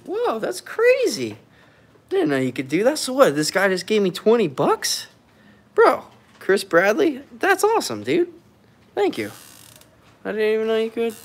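high, delighted surprise; thrilled at an unexpected gift; surprised thanks. Real, not acted. Streamer thanking Chris Bradley for donation, high excited.

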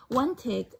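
usual speaking voice

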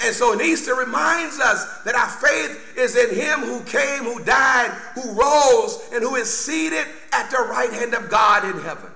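usual speaking voice

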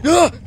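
sudden high scream, then a sharp gasp; real startled scream. Real, not acted. Player ambushed while mining, real startled scream and gasp. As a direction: scream in shock and gasp for breath. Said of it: "I screamed. That scared me so badly".

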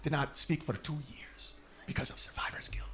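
hushed whisper; low mock whisper of a solemn friend. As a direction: whisper it like a grave secret. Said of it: "She's like".

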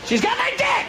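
high, desperate wailing cry; husband wailing down the phone; desperate shriek. Retold or imitated. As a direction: wail it out in despair.

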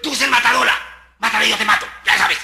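loud scolding of the player; scolding coach. Retold or imitated. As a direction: chew her out loud. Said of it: "La chica se venían".

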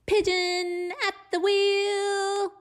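high, slow proud announcement; pigeon voice. Acted. Pigeon proudly announcing itself at the wheel. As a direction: announce yourself grandly.

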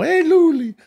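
put-on high squeaky baby talk to a dog. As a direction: coo at the dog high and slow.

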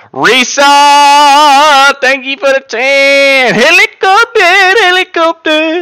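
very high, loud, slow hype yell; hyped shouting. Real, not acted. Streamer hyping a donation over the alert, high loud yell. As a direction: scream the thanks like a siren.

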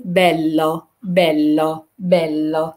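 "بيلو" with a long held l; doubled l. Shown as a demonstration. Teacher drilling double l for learners, doubled l. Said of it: "عندي ال ال، فبقول".

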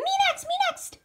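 put-on very high, squeaky kid voice; eager pleading. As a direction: squeal to be picked next. Pony begging for the next turn.

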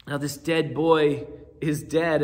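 ordinary talk

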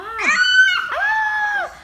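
sharp high startled "ah"; sudden high shout. Real, not acted. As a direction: yelp out suddenly. Sudden cry during play.

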